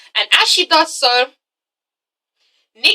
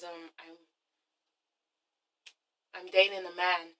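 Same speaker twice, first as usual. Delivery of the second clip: much softer, lower, halting admission; hesitant confession; 6 semitones lower, 11 dB quieter than usual. Retold or imitated. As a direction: falter it out quietly like a scared confession. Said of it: "He's like".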